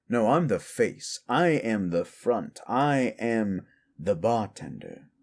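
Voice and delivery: slow, emphatic self-introduction; self-important bartender. Acted. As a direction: draw out each title with relish. Bartender states their position.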